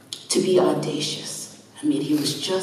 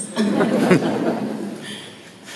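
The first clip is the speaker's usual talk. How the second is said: laugh; 4 semitones higher than usual. Real, not acted. Laughing at her own story.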